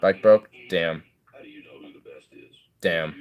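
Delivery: ordinary talk, nothing marked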